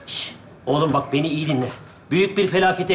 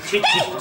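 a high yelp that breaks into a laugh; startled high-pitched yelp with a laugh; sudden squeaky shout. Acted. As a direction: shriek it out, then crack up. Trying a button on the device.

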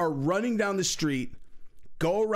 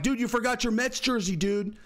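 mocking taunt; 7 semitones higher than usual. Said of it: "I'm like".

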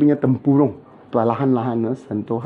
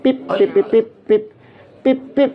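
imitated beeping; 8 semitones higher than usual. Imitating beeps while pressing.

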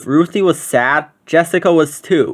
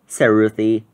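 plain reading voice

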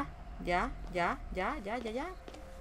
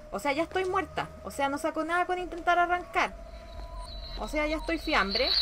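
higher-pitched exasperated protest; 5 semitones higher than usual. Real, not acted. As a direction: complain that you are doomed. Realizing she is already doomed.

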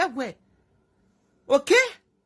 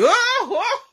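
laughing exclaim; 11 semitones higher, 7 dB louder than usual. Real, not acted. Bursts out after laughing.

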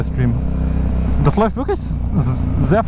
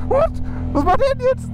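startled. Real, not acted. Startled by a stream sniper.

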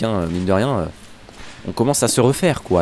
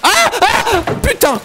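startled yell; 4 dB louder, slower than usual. Real, not acted. Sudden fright in the game.

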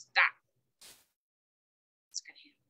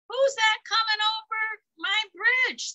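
high, louder friendly call; cheerful ogre; 10 semitones higher, 5 dB louder than usual. A narrator's reading. Little ogre calling to the goat on his bridge. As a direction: sing out cheerfully. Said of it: "the ogre asked cheerfully".

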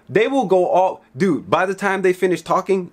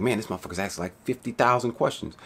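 muttered thought; 5 semitones lower, 8 dB quieter than usual. Retold or imitated. Customer's annoyed inner thought.

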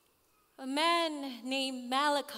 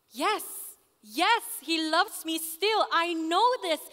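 loud, raised voice insisting on it; emphatic insistence; 4 semitones higher, 9 dB louder than usual. Acted. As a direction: insist passionately, voice lifting high. Insisting that she is still loved.

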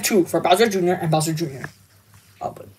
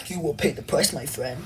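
much softer, lower sinister threat; quiet menace; 6 semitones lower, 13 dB quieter than usual. Acted. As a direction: let the threat sink in, very quiet. Villain threat over donut remark.

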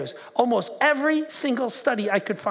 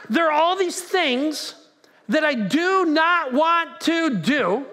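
slow, high, drawn-out words; emphatic frustration as Paul; 4 semitones higher, much slower than usual. Acted. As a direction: stretch out each word in acted exasperation. Preacher voices Paul's frustration.

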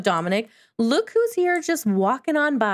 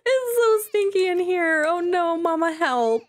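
put-on whiny baby sim; 7 semitones higher than usual. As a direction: whine like a baby begging mama for help. Voicing the fussy baby sim.

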